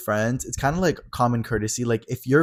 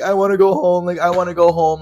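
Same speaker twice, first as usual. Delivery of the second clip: put-on drunk whine; 7 semitones higher, 5 dB louder than usual. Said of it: "his little drunky episode".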